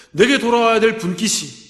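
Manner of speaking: ordinary talk, nothing marked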